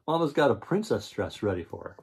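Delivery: usual speaking voice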